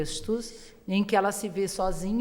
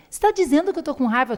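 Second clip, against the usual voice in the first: put-on quick, higher indignant child's retort; indignant daughter; 7 semitones higher, 3 dB louder, faster than usual. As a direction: fire it back as a startled little girl. Daughter protesting mother's remark. (filha indignada, rápida e mais aguda)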